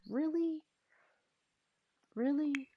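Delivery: surprised. Retold or imitated. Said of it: "She's like".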